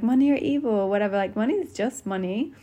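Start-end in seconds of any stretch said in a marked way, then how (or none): 0.0-0.7 mock preachy. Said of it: "some people are like".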